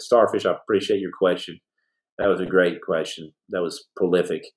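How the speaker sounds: usual speaking voice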